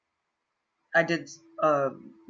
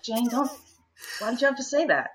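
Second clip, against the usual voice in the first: nervous protest; 7 semitones higher, faster than usual. Retold or imitated. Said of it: "Now I'm nervous".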